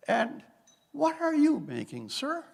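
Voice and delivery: polite regal query, voiced only a little; a hint of the Queen asking a factory worker a question. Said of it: "I can't do the accent".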